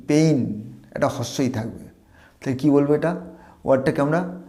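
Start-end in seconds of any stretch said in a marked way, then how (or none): 0.1-0.6 পেইন with a clear long ei glide; pain, not pen. Shown as a demonstration. Teacher giving students the right পেইন. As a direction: say পেইন drawing out the ei. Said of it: "যন্ত্রণা ইংরেজি not পেন".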